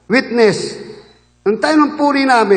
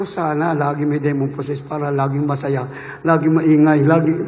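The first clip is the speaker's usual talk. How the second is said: much lower, half-laughing mutter of a guilty wish; wry joking aside; 11 semitones lower, 5 dB quieter than usual. Retold or imitated. Preacher recalling his secret wish for noisy worship, low and laughing. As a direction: play it as a sheepish private joke, low and chuckling.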